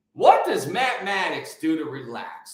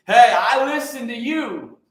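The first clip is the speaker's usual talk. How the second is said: raised and slower, insisting; louder emphatic repeat; 5 semitones higher, 7 dB louder than usual. Real, not acted. To Mama Baker, insisting louder that he listens.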